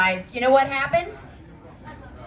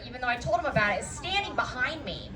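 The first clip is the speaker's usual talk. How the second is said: ordinary talk, nothing marked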